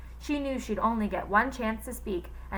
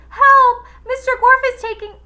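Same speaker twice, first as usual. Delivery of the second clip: high, very loud, fast cry; shout for help; 15 dB louder than usual. A narrator's reading. Girl crying out for help, loud and fast. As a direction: yell for help urgently. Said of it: "shouted Allison".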